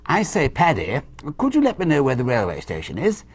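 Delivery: polite imitation of a posh English accent; Englishman's accent. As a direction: ask like a very proper English gent. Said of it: "an Englishman came up to him".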